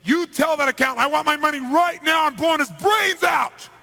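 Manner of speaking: high, full-voiced furious shout; screaming threat. Mafia boss threatening the accountant through the interpreter, screaming. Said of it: "started screaming".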